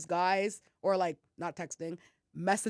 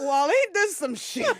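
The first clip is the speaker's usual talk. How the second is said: higher, slow, drawn-out disbelief; exasperated deadpan; 8 semitones higher, 6 dB louder, slower than usual. Retold or imitated. Sober teen walking in on the drunk party, sarcastic. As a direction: let it drip with sarcastic disbelief.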